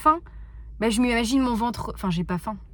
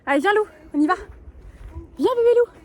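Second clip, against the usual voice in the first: high, loud call to a pet; calling a dog; 10 semitones higher, 5 dB louder, much faster than usual. Real, not acted. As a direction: coax the puppy over, loud and high. Calling her dog to come.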